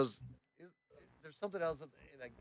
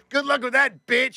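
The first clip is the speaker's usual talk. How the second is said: high, loud sarcastic yell; re-enacted angry taunt; pissed off; 10 semitones higher, 5 dB louder than usual. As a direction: holler it as a parting jab. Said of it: "I'm just pissed".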